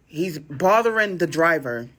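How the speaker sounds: ordinary delivery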